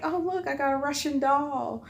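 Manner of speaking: high, excited look-what-I-got voice; playfully delighted reenactment; retold showing off. Retold showing gift to manager.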